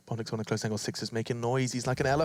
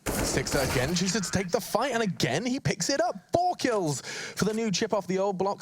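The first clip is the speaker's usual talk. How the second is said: excited; 8 semitones higher than usual. Real, not acted. Caster hype on fourth kill.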